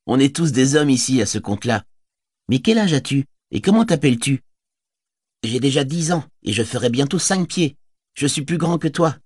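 put-on a cheeky kid boasting; boy Bergil's voice.

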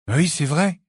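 agree, mildly desperate; hint of despair. A narrator's reading. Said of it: "un ton où perçait le désespoir". (une pointe de désespoir)

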